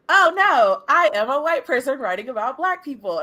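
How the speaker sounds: high, loud, stilted robot voice. Retold or imitated. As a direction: read it like a stiff android. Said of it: "with this robot voice".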